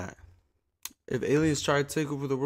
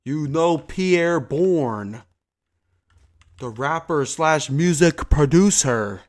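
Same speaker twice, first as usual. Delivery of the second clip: exasperated yelling; 6 semitones higher, 12 dB louder than usual. Real, not acted. Yelling into mic at friend.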